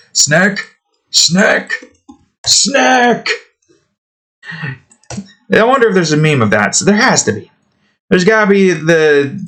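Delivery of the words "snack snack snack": "Snack!" repeated, each climbing higher; snack calls. Shown as a demonstration. Playfully repeating "Snack!" for the snake meme, higher each time. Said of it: "there you go".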